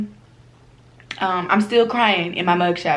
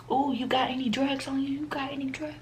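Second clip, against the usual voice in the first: hushed, secretive whisper, higher; sketchy whisper; 6 semitones higher, 9 dB quieter than usual. Retold or imitated. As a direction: whisper like you're asking for contraband. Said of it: "She was acting like a crackhead".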